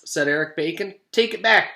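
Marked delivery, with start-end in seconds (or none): 1.1-1.7 loud demand. Acted. Kids rejecting loudly.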